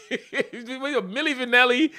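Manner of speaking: breaks into a laugh on the name. Real, not acted. Retelling how the comedian roasted him, laughing. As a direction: laughs out the nickname, drawn out.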